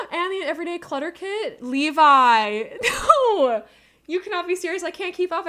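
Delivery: high, loud gasping disbelief; thrilled disbelief; excited squeal. Real, not acted. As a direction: squeal as gifts pile up. More gifts keep arriving.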